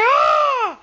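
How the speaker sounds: pained scream. Retold or imitated. Boy shrieking in pain. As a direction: shriek as she tears your leg.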